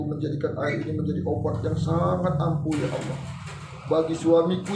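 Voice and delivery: model prayer. Shown as a demonstration. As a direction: murmur a heartfelt plea to Allah. (doa memohon dengan suara rendah)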